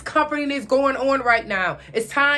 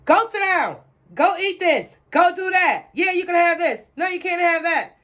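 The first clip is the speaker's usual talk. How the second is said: distracted parent; 6 semitones higher than usual. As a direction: bark quick dismissals at the kids. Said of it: "was not raising them".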